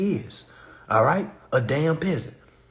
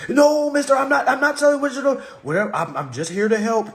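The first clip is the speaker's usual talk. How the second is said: higher, louder, hurried scared rep; scared agent; 7 semitones higher, 5 dB louder than usual. Retold or imitated. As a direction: stammer it out like a nervous rep. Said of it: "Theodore be scared as hell".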